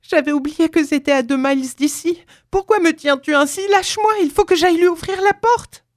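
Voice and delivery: put-on high, frantic old mother; high-pitched outburst building to a shriek; struggling cry. A narrator's reading. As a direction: protest desperately while fighting off a grip. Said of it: "s'écria-t-elle en se débattant".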